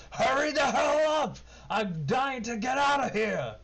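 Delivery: very high, loud shout; impatient halfling yell. Acted. Trapped halfling yells at the rescuers to hurry, high shout. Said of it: "he says".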